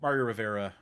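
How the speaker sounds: ordinary talk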